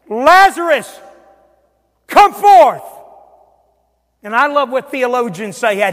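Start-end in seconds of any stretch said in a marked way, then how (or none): 0.1-2.8 loud, high, ringing command; big preacher's shout as Jesus; commanding call. Acted. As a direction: call the dead out of the tomb. Voicing Jesus calling Lazarus out.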